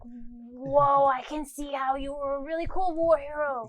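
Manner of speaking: long, loud gushing holler; mock awed shout. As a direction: shout up slowly as an in-character admirer. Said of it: "like, shout up at you".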